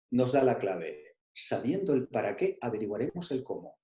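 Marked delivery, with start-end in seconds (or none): none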